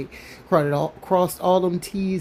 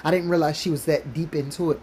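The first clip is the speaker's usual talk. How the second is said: ordinary talk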